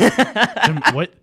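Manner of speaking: a startled laugh breaking through the word; laughing in disbelief. Real, not acted. Friend laughing in disbelief at a Queens birth claim. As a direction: laugh it out, caught off guard.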